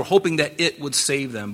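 "it" picked out with clear emphasis; stress on IT. Shown as a demonstration. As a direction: make "it" the key word of the phrase. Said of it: "I emphasize the word "it".

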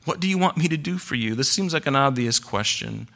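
usual speaking voice

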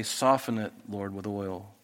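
soft, hushed plea to God; soft prayer voice; quiet prayer. Shown as a demonstration. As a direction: pray it softly and humbly. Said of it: "Then I have to pray".

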